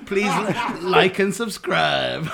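slow, loud mock YouTuber sign-off; Bill Cosby. Podcaster playing the comedian as a YouTuber, hammed-up Cosby. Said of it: "Cosby on YouTube".

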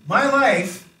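loud, high call for the crowd to echo. Shown as a demonstration. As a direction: belt it out for them to repeat. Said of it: "repeat after me".

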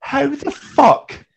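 high, slow, loud "how the fuck"; incredulous slow exclamation; stunned disbelief. Retold or imitated. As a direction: drag it out in sheer disbelief. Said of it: "you're just going".

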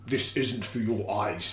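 soft, slow, low take; Pinhead. Acted. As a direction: intone it quietly and very slowly. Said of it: "Not the way I do it".

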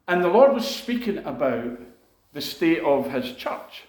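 normal talking voice

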